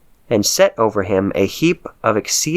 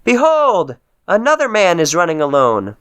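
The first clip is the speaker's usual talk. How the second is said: called out; 9 semitones higher than usual. Acted. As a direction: sing out the sighting to those below. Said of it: "the watchman yelled out at the gate and said".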